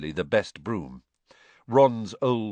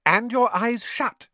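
Mrs Weasley, fussing; 6 dB louder than usual. A narrator's reading.

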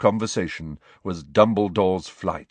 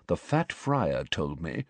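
softer, slow, dropped voice; conspiratorial; 3 semitones lower, 6 dB quieter than usual. A narrator's reading. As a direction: lean in and share a secret. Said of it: "He dropped his voice conspiratorially".

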